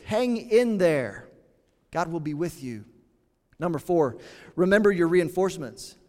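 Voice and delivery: ordinary delivery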